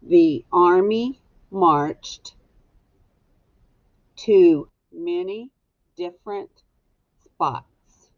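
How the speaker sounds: very slow, word by word dictation. Shown as a demonstration. Teacher dictating the sentence word by word for writing. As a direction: space the words out so they can be written down. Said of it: "This goes on number one".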